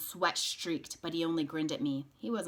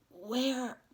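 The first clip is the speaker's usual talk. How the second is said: hoarse; 6 semitones higher than usual. Acted. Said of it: "I said hoarsely".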